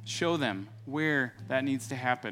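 fervent spoken prayer, building in force; pastor's closing prayer voice; earnest prayer. Acted. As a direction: pray aloud over the congregation.

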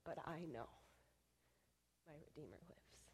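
whispered declaration of faith; hushed whisper. Retold or imitated. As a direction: voice drops to a breathy whisper on my Redeemer lives. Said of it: "she stood and declared".

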